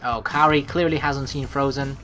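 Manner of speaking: ordinary delivery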